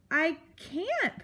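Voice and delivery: high, pleading little voice; frightened cry. A narrator's reading. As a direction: plead with the porcupine, scared. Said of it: "Poppy cried".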